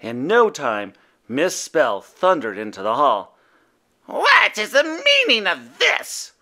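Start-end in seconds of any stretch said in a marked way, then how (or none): 4.1-6.3 a higher mild boom of a stern witch; stern witch demand. A narrator's reading. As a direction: scold the children. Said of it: "she boomed".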